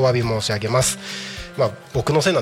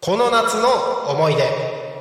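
announcer build-up; 8 semitones higher, slower than usual. Acted. Announcing the weekly theme.

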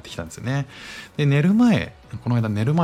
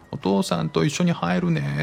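little son announcing; 10 semitones higher than usual. Retold or imitated. Son tells dad in kitchen.

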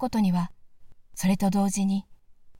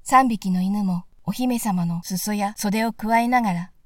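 usual speaking voice

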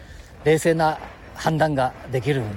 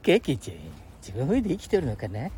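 usual speaking voice

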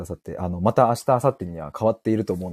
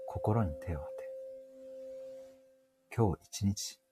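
mock guided meditation; 14 dB quieter than usual. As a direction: lead listeners into calm like a spiritual guide. Parody guided meditation, crystal ball. (ささやくような、ゆっくりしたパロディの瞑想誘導)

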